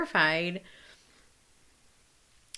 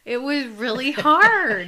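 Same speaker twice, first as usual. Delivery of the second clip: on the verge of tears; 8 semitones higher, 11 dB louder, slower than usual. Real, not acted. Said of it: "I could cry now".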